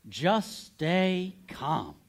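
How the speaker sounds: very slow, calm unison phrase. Shown as a demonstration. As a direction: lead the room, drawing it out. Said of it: "Can we say those out loud together?".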